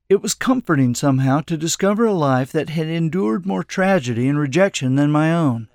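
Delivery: ordinary delivery, nothing marked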